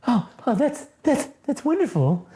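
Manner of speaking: a hint of a tender young husband; young Anakin. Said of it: "and then".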